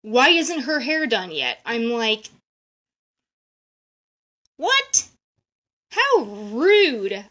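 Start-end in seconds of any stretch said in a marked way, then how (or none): 0.0-1.6 mock angry boss yelling; a hint of a yelling boss; imitated yelling boss. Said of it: "starts yelling at her". 4.6-7.2 high shocked squeak then indignant; retold shocked disbelief. Shocked reaction to rude boss.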